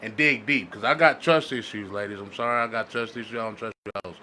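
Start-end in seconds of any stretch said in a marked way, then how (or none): none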